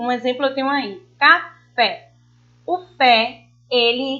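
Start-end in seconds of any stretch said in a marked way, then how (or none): 1.2-2.1 café broken in two, FÉ pushed out louder; ca-FÉ, the FÉ punched with more force; stress on the last syllable. Shown as a demonstration. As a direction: say café with a strong final FÉ. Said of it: "a gente pronuncia com mais intensidade".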